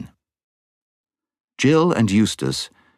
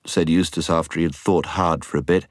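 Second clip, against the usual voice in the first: ordinary delivery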